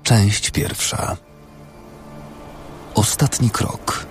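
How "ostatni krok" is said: usual speaking voice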